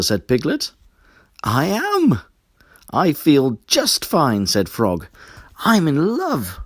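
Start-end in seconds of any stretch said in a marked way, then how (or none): 1.4-4.5 high, louder happy frog voice; bouncy lovestruck reply; cheerful. Acted. 5.6-6.6 high, louder dreamy announcement; giddy frog in love; lovestruck. Acted.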